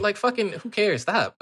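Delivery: high, fed-up dismissal; exasperated. Retold or imitated. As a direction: wave it off like you're sick of hearing it. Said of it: "And I was like".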